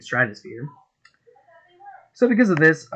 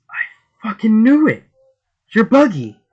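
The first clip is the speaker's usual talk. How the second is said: excited shout; 11 semitones higher, 10 dB louder than usual. Acted. Luffy shouts recognizing Buggy.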